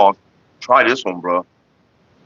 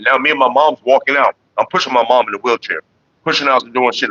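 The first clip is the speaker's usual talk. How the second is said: usual speaking voice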